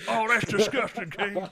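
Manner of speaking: mock commentator voice. Mock commentator reacting to the show.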